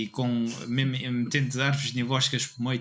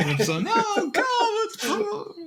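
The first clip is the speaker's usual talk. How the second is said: mock squeal. Mock pleading to stop.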